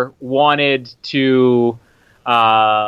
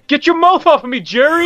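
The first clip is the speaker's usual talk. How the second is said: shouting; 12 semitones higher, 4 dB louder than usual. Acted. Acting soldiers in gas scene.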